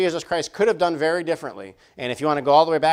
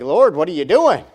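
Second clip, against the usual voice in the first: exasperated; 3 semitones higher, 9 dB louder than usual. Retold or imitated. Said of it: "You're gonna be like".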